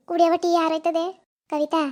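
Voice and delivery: normal talking voice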